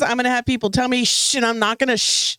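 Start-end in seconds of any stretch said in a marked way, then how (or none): 1.0-1.4 unvoiced "shh"; hushing shush. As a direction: shush someone in a meeting. Acting people hushing her.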